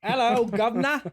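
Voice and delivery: high, loud hammed-up British greeting; cheeky Cockney-style hello; mock British accent.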